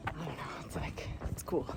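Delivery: soft whisper, barely voiced; whispered aside. Real, not acted. Hiker musing to herself about the pilgrims, whispered. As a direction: let it slip out under your breath. Quiet aside while walking.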